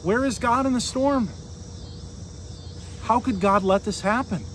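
normal talking voice

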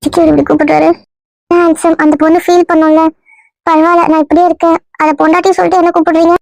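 a lightly put-on girl's voice, hurt and coy; woman's voice. Disguised man plays the girl wooed by the husband. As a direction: play the wronged young woman, only lightly feminine. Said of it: "இன்னும் பொம்பளை குரல்ல தான் பேசுற".

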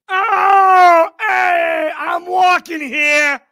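loud, high street-tough holler, drawn out; tough New Yorker yell. Pretend. Reacting to the mobster clip, a loud mock New York tough-guy shout. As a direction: bark it like a cabbie cut off in traffic.

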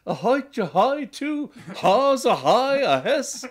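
mock Cockney voice with stray h-sounds, pitched well up; Cockney accent. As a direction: spell it out like a Cockney Englishman in a London pub. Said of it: "So a Cockney cook".

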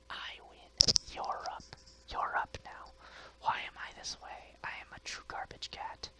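hushed gloating confession; sly self-satisfied whisper; smug whisper. Acted. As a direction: whisper it so the owner won't hear. Cat whispering victory over owner.

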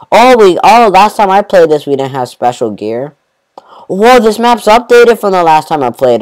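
high and loud, bursting with delight; thrilled to find something new; excited. Real, not acted. Gamer excited to his viewers that the map updated.

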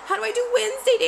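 high loud flustered cry; flustered squeal. Real, not acted. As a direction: squeak in comic panic. Flustered about camera setup.

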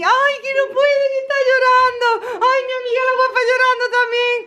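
high, sing-song pity over the crying winners; high pitying coo. Real, not acted. Watching lottery winners cry on TV, high pitying coo. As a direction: coo "ay, qué lástima" high with tender pity.

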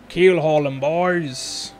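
loud pirate captain's order. Acted. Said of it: "That's a weird pirate voice".

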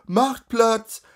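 high, loud repeated crier's call; crier's shout. Acted. A crier shouting at the crowd to make way, loud call. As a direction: bellow again to part the crowd.

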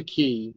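quay said like "key". Real, not acted. Reading history aloud to a partner, quay pronounced "key". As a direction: say quay as "kee". Reader's pronunciation, then debated.

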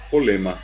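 "polema", pro cut to po, ble to le; Guaraní-style "polema" for problema; reduced clusters. Shown as a demonstration. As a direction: say "polema" with simple syllables only. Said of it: "pro a po, ble a le".

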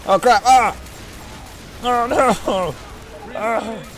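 loud, high cries of alarm; panicked yelling. Real, not acted. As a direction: yell out as if suddenly under attack. Panic during attack in game.

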